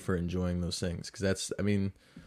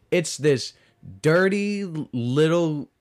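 hint of leprechaun; 7 semitones higher, 7 dB louder, slower than usual. Describing leprechaun menacingly.

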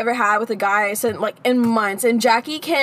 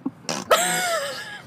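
squeaky high burst of giggles; 6 dB quieter than usual. Real, not acted. Laughing at own joke.

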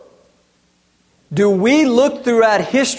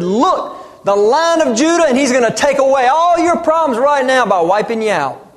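loud and higher, a conquering king's announcement; booming victory cry, half-mocking; imagined triumphant proclamation; 7 semitones higher, 4 dB louder than usual. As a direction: proclaim it like a warrior messiah. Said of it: "John could have said".

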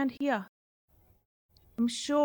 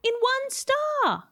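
delighted; 8 semitones higher than usual. Real, not acted. Showing off a tiny nebula.

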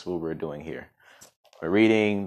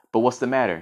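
a touch of bravery; mildly bold question; bravely; 6 semitones higher, 5 dB louder than usual. A narrator's reading. Little quill facing the falcon, bravely. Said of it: "he added bravely".

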